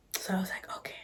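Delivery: hushed whisper. Real, not acted. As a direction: whisper it under your breath. Whispered retelling.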